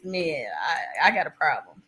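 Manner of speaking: ordinary talk, nothing marked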